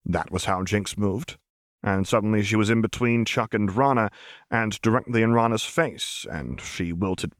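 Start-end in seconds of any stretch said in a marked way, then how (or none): none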